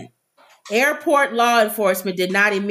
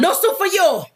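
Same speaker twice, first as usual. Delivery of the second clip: higher, barked mock Soup Nazi line; Soup Nazi impression; 8 semitones higher than usual. Host quotes the Seinfeld soup man in a barked mock voice. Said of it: "the soup Nazi".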